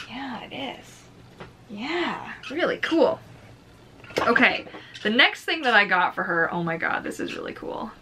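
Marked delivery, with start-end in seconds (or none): 0.1-3.2 pet-talk reply. Real, not acted. 6.5-7.9 awed whisper. Real, not acted. Awed by new item.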